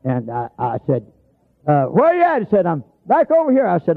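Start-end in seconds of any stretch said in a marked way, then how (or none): 1.7-2.4 high, carrying call; hollering back. Retold or imitated. Hunter answering a lost man, hollering back. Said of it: "I got hollering back at him". 2.7-3.7 high-pitched far-carrying reply; hollering back. Retold or imitated. Lost man answering the hunter, hollering back. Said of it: "I got hollering back at him".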